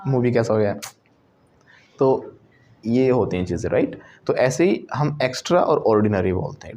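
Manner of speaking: ordinary delivery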